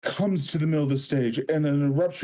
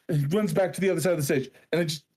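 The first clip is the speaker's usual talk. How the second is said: ordinary delivery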